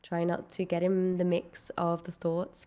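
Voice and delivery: ordinary talk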